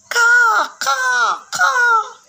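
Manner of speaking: very high, loud cawing; shrieking crow calls; crow caws. Shown as a demonstration. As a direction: caw like a crow. Teacher imitates crows.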